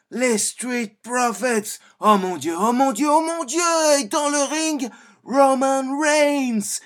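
wrestling commentator. Shown as a demonstration. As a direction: build from excited to screaming ringside frenzy. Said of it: "Doublage commentaire catch".

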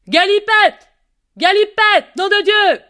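put-on loud, high-pitched shout for a runaway child; full-voiced angry holler; father calling out. A narrator's reading. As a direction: yell the name like an exasperated father. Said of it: "Son père l'appelle".

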